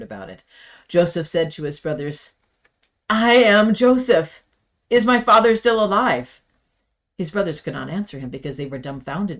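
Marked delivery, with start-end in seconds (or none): 3.1-6.4 loud, higher, slow reveal; emotional outcry. A narrator's reading. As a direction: burst out the truth to his brothers. Joseph reveals himself to brothers.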